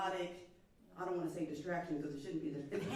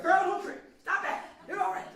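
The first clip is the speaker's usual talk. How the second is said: loud, high, agitated mock reassurance; hammed-up anxious outburst; anxious comforting; 12 semitones higher, 13 dB louder than usual. Shown as a demonstration. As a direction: exaggerate frantic comforting, voice shooting up. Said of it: "come to somebody anxious, being anxious".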